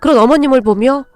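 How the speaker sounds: ordinary delivery, nothing marked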